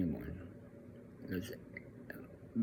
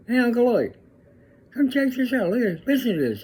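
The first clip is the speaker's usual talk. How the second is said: much higher, louder, excited shout down the phone; excited calling out; 11 dB louder than usual. Retold or imitated. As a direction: call your brother over, bursting with pride. Dad excitedly calls uncle on phone.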